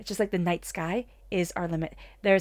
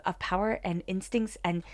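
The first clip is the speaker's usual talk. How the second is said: ordinary talk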